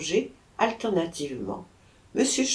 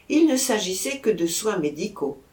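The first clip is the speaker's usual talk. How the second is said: ordinary delivery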